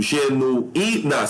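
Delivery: "Genuína" very slow, split ge-nu-í-na; syllable by syllable. Shown as a demonstration. Teacher checks syllable division, "Genuína" drawn out in pieces. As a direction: separate u and í in "Genuína". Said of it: "correta organização das sílabas".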